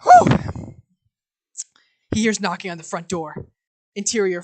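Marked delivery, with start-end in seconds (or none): 0.0-0.7 high airy exhale after laughing; breathless whew. Acted. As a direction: blow out a winded whew.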